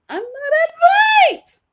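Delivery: squeaky high cartoon mouse yell; Mickey Mouse voice. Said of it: "he goes full Mickey Mouse".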